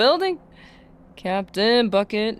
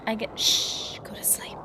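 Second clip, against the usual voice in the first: whisper; 3 semitones higher, 7 dB quieter than usual. Real, not acted. Hushing the game.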